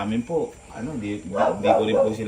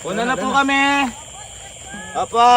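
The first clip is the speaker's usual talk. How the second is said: raised, high-pitched farewell call; calling out goodbye; 12 semitones higher than usual. Real, not acted. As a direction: call your goodbye to people further off. Calling goodbye as they leave. (pasigaw na pamamaalam)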